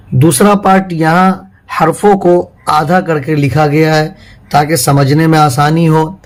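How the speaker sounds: usual speaking voice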